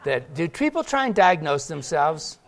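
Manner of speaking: usual speaking voice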